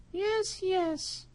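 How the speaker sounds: very high squeaky mouse voice; eager little mouse agreeing. Acted. Mouse answers cat.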